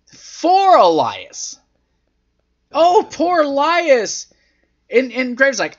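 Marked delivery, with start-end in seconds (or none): 2.7-4.3 high, drawn-out "poor you" lament; exaggerated pity; mock sympathy. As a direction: imitate a fawning announcer, pitched up and slow. Voicing the sympathetic announcer.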